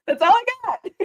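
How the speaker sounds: high, laughing finish; giggly sign-off. Real, not acted. Ending her on-camera read, laughing. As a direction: wrap it up through a giggle.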